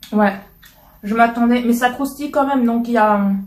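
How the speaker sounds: eating and tasting aloud; talking while chewing. Real, not acted. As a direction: mouth partly full of chocolate. Seen 2: Holds bitten piece; closed, working lips between phrases while talking.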